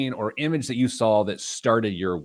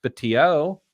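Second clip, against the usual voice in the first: higher, louder announcer-style welcome; show host intro; 7 semitones higher, 4 dB louder than usual. Acted. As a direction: open the show like a radio host.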